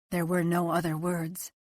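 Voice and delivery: plain reading voice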